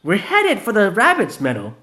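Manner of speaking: high, loud brisk question; business-like ask. Acted. Vanilla asking the way, brisk high voice. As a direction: ask it matter-of-fact. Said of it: "asked in a business-like way".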